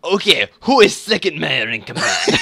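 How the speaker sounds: high, loud, slow mock Russian; bad Russian accent. Russian player questioning the town, bad Russian accent. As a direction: demand it in a hammy Russian accent. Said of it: "very bad attempt at a Russian accent".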